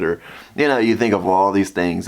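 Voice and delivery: ordinary talk, nothing marked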